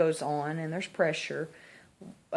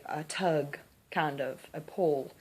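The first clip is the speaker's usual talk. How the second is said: usual speaking voice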